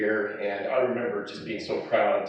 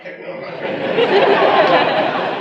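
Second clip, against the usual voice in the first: high, loud, slow quote broken by laughter; laughing refusal; 10 dB louder, much slower than usual. Retold or imitated. Son retelling refusing his dad's dare, laughing hard. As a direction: laugh your way through the refusal.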